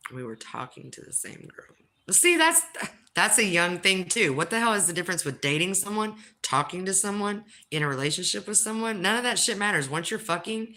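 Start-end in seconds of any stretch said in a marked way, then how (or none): 2.1-10.7 heated rant. Real, not acted.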